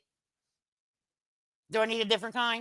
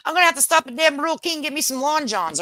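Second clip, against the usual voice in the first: higher, louder, fast excited; 8 semitones higher, 6 dB louder, faster than usual. Real, not acted. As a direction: rattle it off eagerly. Excited plan about cold.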